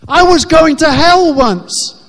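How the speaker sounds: loud, slow and weighty; booming proclamation. Real, not acted. Preacher booming his past to the congregation, loud and slow. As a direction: proclaim it to the hall.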